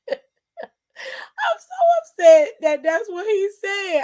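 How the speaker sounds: high, slow, tearful laugh-talk; upset laughing. Real, not acted. Upset at what he said, laughing and crying. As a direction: drag it out through helpless laughter.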